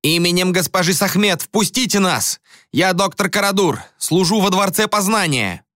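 commanding shout. A narrator's reading. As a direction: call out with authority. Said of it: "Карадур закричал".

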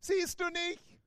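hammed-up drowning believer; mock panic. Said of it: "Und nicht".

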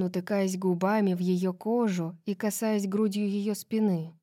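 usual speaking voice

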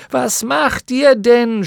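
angry, startled high shout. A narrator's reading. Bastian, angry and shocked at the cracking tower, shouting. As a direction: yell it high and drawn out. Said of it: "schrie Bastian".

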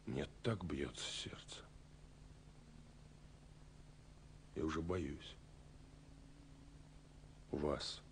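breathless, barely voiced confession; a hushed, shy whisper of nerves; nervous whisper. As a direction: whisper it as if your heart is racing. Heart pounding before the meeting.

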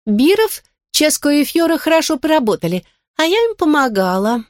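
squeaky high helium voice; tiny creature chirping high. A narrator's reading. Talking bowl speaks.

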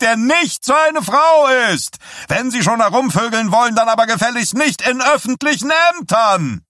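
a candidate preaching his program to a roaring crowd; rabble-rousing preacher. A narrator's reading. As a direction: whip the crowd up, stretching the punchline. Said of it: "aufpeitschende Sing-Sang-Stimme eines Erweckungspredigers".

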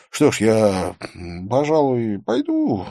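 hesitant, drawn-out and slow; Vikram mumbling. A narrator's reading. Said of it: "промямлил Викрам".

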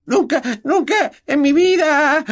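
a house-elf overwhelmed to tears; tearful elf. A narrator's reading. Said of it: "el elfo rompió a llorar".

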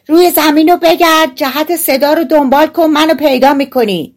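high-pitched tiny character calling up; loud, piercing voice. A narrator's reading. Tiny hero guides thieves to him, calling out high. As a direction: call out so they can find you. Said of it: "صدای بلند و گوشخراشی داشت".

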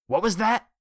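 loud, high sharp snap; snapped. A narrator's reading. Jellyfish snapping at Richard's remark, loud sharp snap. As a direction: snap back at him. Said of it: "Myron snapped".

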